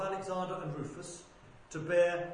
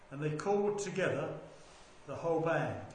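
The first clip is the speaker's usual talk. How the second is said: ordinary delivery, nothing marked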